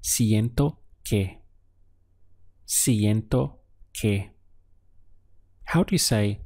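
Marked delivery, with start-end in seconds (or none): 0.0-1.4 "siento que" said slowly and clearly; slow model phrase. Shown as a demonstration. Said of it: "it would be". 2.7-4.3 "Siento que" repeated even slower. Shown as a demonstration. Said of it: "it would be".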